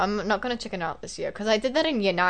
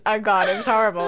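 ordinary talk, nothing marked

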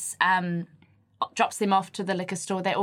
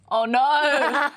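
high, drawn-out oh no; amused dismay; 5 semitones higher, 4 dB louder than usual. Real, not acted. Co-host hearing an awkward boyfriend mix-up, amused dismay. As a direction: groan out in sympathetic horror. Reacts to awkward scenario just read.

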